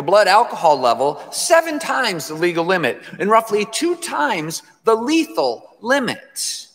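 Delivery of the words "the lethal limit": lethal drawn out slowly and raised in pitch; slow, weighty LETHAL; stress on lethal. Real, not acted. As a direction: lean hard on the word lethal. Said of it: "Emphasis on lethal".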